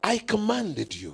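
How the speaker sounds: high, firm emphasis on "I"; stress on I. Shown as a demonstration. Preacher repeats God's words to the congregation, stressing "I". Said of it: "the emphasis is".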